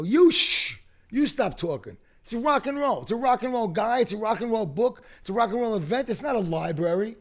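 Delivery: loud high retort. Retold or imitated. Narrator snapping back at the shushing woman, loud indignant retort. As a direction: fire it back at her, indignant. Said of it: "I go".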